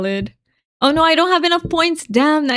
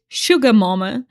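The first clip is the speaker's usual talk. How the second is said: normal talking voice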